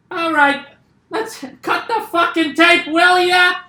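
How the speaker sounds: ranting comic, pushing up and loud; Bill Burr impression. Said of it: "I've been practicing a Bill Burr impression".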